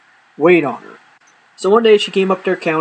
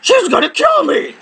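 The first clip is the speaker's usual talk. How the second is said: mock outrage; 9 semitones higher than usual. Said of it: "I'm like".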